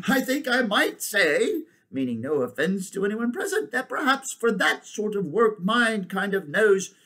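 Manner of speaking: high, deliberate elephant voice; self-satisfied elephant. Acted. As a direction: put it politely but proudly. Elephant figure in story.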